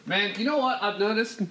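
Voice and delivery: ordinary talk, nothing marked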